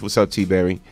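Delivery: ordinary talk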